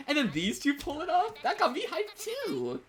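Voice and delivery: high, excited cheer, slowing on the last words; real hype. Real, not acted. Viewer hyped as two characters pull it off, cheering high. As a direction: let the thrill burst out high.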